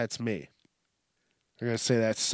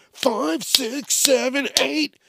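high-pitched mock money counter; oblivious ATM customer; 11 semitones higher, slower than usual. Customer counting cash at open window, high oblivious mock voice. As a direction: play the clueless customer in a high voice. Counting continues after being hit.

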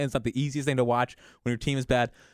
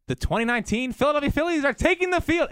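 high stadium announcer call; mock announcer; 10 semitones higher than usual. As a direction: announce it to the crowd.

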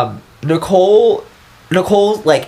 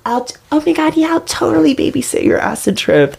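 high-pitched ditzy socialite impression; 12 semitones higher than usual. Imitating the pop singer to her crowd, ditzy socialite voice. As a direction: gush it in a high, put-on party-girl voice. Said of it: "She was [name]".

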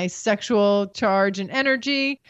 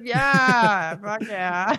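faked eager yes; much slower than usual. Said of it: "I fucking lied and said".

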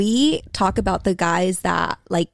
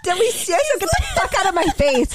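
much higher, theatrical brush-off; mock diva dismissal; 9 semitones higher than usual. Dismissing the polite guy, high theatrical brush-off. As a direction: wave him away like a diva.

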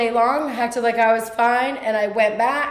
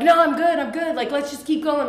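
higher-pitched imitated insistence; acted brush-off; 5 semitones higher, 3 dB louder than usual. Hurt employee deflecting her tough boss, insistent brush-off. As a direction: quickly wave off the worry, voice lifted. Acting out deflecting the boss's concern.

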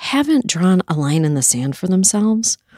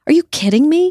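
pitched way up and louder, exasperated; outraged disbelief; 10 semitones higher, 4 dB louder, faster than usual. Real, not acted. Host to absent officials, higher, louder, outraged disbelief. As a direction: throw the question back in utter disbelief. Furious rant about Lindsey Graham.

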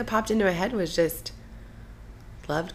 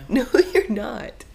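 loud, high, drawn-out denial; emphatic contradiction; 7 semitones higher, 9 dB louder, slower than usual. Real, not acted. Host teasing co-host about fast talking, emphatically insisting. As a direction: insist slowly, pitch and volume jumping up. Repeats denial, more forcefully.

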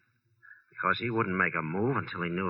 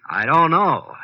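exasperated outburst; 7 semitones higher, 9 dB louder, much slower than usual. Acted. Suspect pressed third time.

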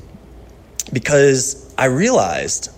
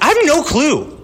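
much higher, "have" pushed up in helpless exasperation; despairing confession. Retold or imitated. Pastor retelling his answer about the church plant, helplessly exasperated. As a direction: cry out that you really don't know. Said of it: "I was like".

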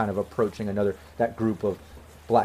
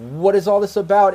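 higher, louder challenge; female voice; 8 semitones higher, 7 dB louder than usual. Acted. Woman stepping up to the standoff, in a raised female voice. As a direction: step in demanding answers, pitched up. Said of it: "you hear a female voice like".

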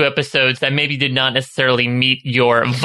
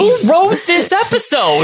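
high exasperated squeal; incredulous; slower than usual. Acted. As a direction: ask it in disbelief.

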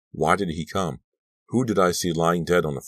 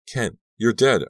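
usual speaking voice

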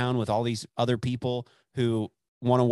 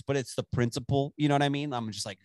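usual speaking voice

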